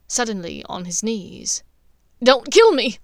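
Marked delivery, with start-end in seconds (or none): 2.2-3.0 loud, terrified cry for his life; scared plea. A narrator's reading. Snape on his knees begging Dumbledore, terrified and loud. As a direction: beg not to be killed. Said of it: "voice was scared".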